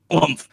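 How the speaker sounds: loud booming woomph sounds; mock coffin thuds; coffin thud noise. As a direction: thump it out like a heavy coffin hopping. Coffin bashing door.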